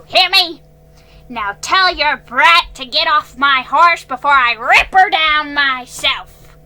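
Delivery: put-on old man refusing to sell, threatening the girl; furious ordering. A narrator's reading. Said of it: "he ordered".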